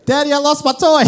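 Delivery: high, loud mock child's wail; Aiden. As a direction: imitate a little boy crying out to Daddy. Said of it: "And what happens?".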